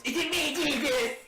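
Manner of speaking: high, loud mocking echo of the question; sarcastic high mimicry of the traitor's question; sassy Jesus. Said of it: "that's what you sound like".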